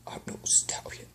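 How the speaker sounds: soft whispered, unlike himself; broken whisper. Acted. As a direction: whisper it, heartbroken. Grieving husband whispers.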